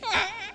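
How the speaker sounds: soft mock whimper of the abandoned wife; a small fake cry. Wife left in line of fire.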